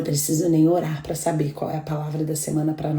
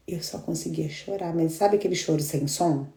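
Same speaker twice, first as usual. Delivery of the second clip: ordinary talk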